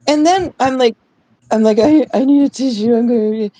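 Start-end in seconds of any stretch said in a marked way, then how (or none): 1.8-3.5 higher, mock-tearful request; lightly acted weepy stammer; holding back tears. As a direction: imitate someone trying not to cry. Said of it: "I'm trying not to keep crying".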